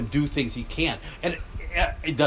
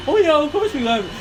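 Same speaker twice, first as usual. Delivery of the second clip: high, loud mock-confident American buddy; sarcastic imitation of an American friend; mock American certainty; 13 semitones higher, 5 dB louder than usual. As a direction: ham up the sure-of-himself believer. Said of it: "for Americans".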